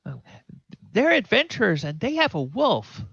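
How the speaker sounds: put-on a proud little boy showing off; kid voice. Pip telling the farm woman about the wolf, high and excited. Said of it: "exactly what a kid would say".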